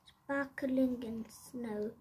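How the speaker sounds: ordinary delivery, nothing marked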